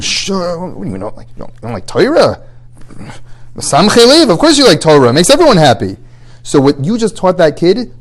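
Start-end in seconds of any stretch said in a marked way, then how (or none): 0.0-6.0 hushed alarm leaping to high, loud insistence; nervous parent. Acted. Parents shutting down a child who dislikes Torah, very nervous. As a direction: shush in a panic, then overrule loudly from high up. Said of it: "will get very nervous and".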